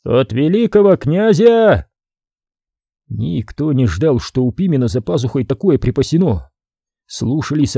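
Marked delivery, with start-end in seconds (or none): 0.0-1.9 booming read. A narrator's reading. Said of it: "загудел".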